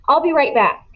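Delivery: lightly voiced sly boy; mock innocent. A narrator's reading. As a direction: toss it off with fake cheer. Dylan's excuse to teacher.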